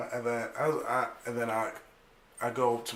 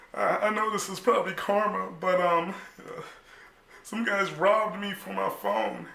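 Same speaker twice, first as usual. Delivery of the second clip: upset confession; 9 semitones higher, 4 dB louder than usual. Retold or imitated. Telling dad about robbery, upset.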